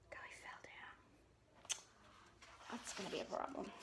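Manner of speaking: soft unvoiced murmur; whispered aside. Real, not acted. Reader whispers to the child about Papa's fall.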